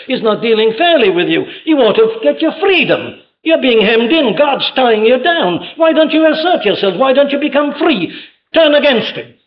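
high, insistent devil voice egging them on; tempting devil. Retold or imitated. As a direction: needle them into rebellion, pitched well up. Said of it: "the devil comes along and he says".

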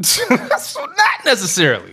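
high, slow, laughing yell; laughing shout. Real, not acted.

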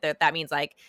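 usual speaking voice

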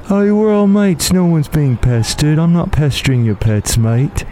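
put-on lazy British accent, slowed down; a chummy English cat greeting his mates. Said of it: "how I imagined this cat talked".

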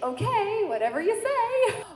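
high, singsong brush-off; mock-agreeable high voice; breezy dismissal. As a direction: lilt it up high, humouring him. Retold breezy reply to deacon's warning.

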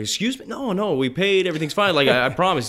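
high, rushed retold protest; mock panic, pitch jumping up; flustered denial.

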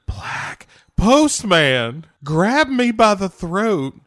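light southern lady voice; hammed-up lady. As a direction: overplay the choking story. Playing the movie character.

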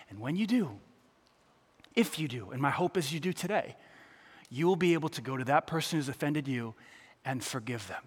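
hushed pastoral plea; intimate quiet appeal. Acted. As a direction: lean in and speak heart to heart. Preacher drops to intimate appeal.

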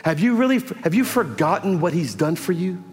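preaching. Real, not acted. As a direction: press the crowd with rising passion. Preaching climax.

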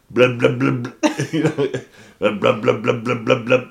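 laughing mock engine noise; mock Harley engine noise; motorcycle engine noise. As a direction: do the Harley revving sound with your mouth. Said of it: "make the sound like you're on a Harley".

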